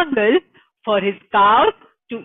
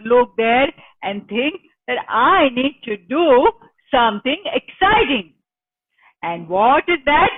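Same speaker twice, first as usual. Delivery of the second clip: ordinary delivery, nothing marked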